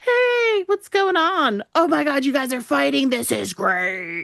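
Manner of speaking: high, eager, then slow and savoring this is great; a troublemaker thrilled at the fighting; hammed-up glee at a fight; gleeful mock delight. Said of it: "a Hau comes up and he's just like".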